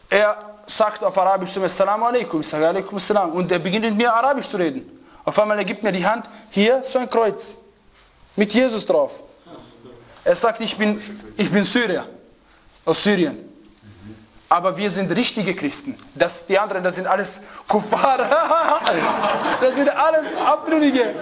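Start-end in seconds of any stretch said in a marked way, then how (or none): none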